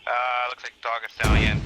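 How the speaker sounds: loud urgent report; shouted callout. Real, not acted. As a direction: yell it across the field. Combat callout over gunfire.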